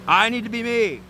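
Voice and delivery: high, emphatic mock outburst; acted pissed off. Aries insisting on being himself, acted mad. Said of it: "you'll get all mad. You'll be so pissed".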